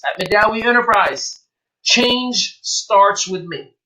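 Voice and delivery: slow, deliberate brand tagline; proud, slow company motto; slogan delivery. Shown as a demonstration. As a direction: land each word of the promise. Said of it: "your brand is your promise".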